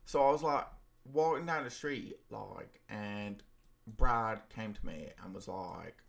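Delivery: put-on Essex accent, lively start then dropping. Shown as a demonstration. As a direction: chat like someone from Essex. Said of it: "I can do the Essex accent".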